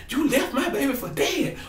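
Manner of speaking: hammed-up mother wailing the accusation; imitating an outraged mama; mock upset mother. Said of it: "Mama D was upset".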